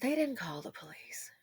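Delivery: breathy hushed aside, barely voiced; hushed whisper. Real, not acted. Vlogger to viewers about the killing, a hushed whisper. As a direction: drop to a whisper to let it land. Grim aside after story.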